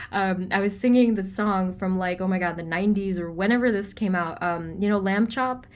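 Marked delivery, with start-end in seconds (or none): none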